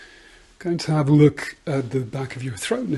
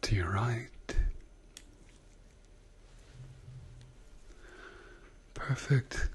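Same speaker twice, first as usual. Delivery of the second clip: whispering; 11 dB quieter than usual. Acted. ASMR doctor exam roleplay.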